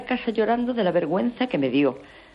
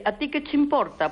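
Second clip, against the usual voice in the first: much higher, curt; mock curt retort; 10 semitones higher than usual. Caller mimicking neighbor's retort, high and curt. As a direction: fling it up high and snippy.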